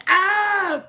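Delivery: very high, loud shriek; startled high "Ah!"; horrified scream. Acted. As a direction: scream in sudden horror. Character screams in disgusted fright.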